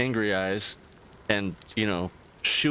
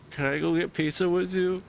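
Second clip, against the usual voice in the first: hopeful tag-along; 7 semitones higher than usual. Retold or imitated. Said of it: "And he's like".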